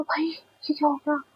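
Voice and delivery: whispered, stunned by the sudden fall; shocked whisper. Retold or imitated. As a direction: breathe the words out in disbelief. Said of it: "ऐसा लगा". (हैरानी से फुसफुसाकर)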